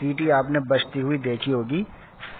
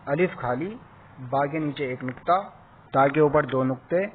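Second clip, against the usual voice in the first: ordinary talk, nothing marked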